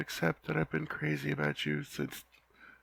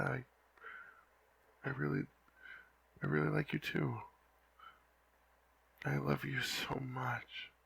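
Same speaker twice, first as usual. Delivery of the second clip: tender whisper; 6 dB quieter than usual. Acted. Whispered love confession.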